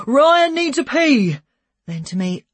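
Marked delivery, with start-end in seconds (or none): 0.1-1.4 high, loud, drawn-out call. A narrator's reading. Policeman calling to his partner, drawn-out shout. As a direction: holler it slowly across to a colleague. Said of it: "Gary called to Chris".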